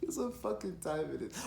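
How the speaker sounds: ordinary talk, nothing marked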